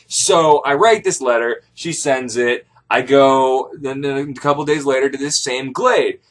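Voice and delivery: ordinary delivery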